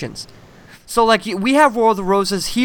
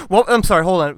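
usual speaking voice

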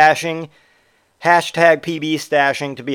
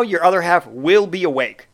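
ordinary talk, nothing marked

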